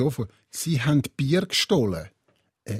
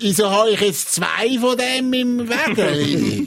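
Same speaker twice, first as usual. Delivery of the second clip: put-on town drunk; 5 semitones higher, slower than usual. Acting drunk shopper.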